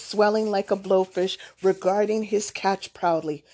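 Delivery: ordinary delivery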